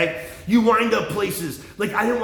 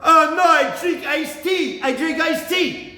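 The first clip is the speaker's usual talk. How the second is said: high, drawn-out dad imitation; mock dad voice; 7 semitones higher, much slower than usual. Dad answering about coffee, high mocking imitation. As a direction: imitate dad, slow and pitched way up. Mimics dad's proud answer.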